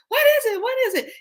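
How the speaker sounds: high-pitched panicked imitation; mock alarm. Pranked man trying to see, mock alarm. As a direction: squeal it like a spooked man.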